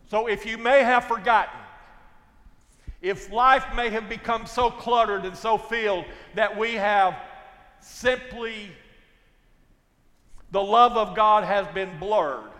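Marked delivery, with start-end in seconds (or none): none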